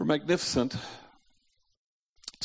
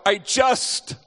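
blurted out in fear; 10 semitones higher, 7 dB louder than usual. Retold or imitated. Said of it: "suddenly he blurts out".

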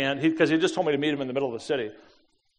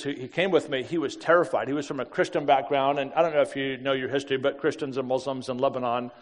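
usual speaking voice

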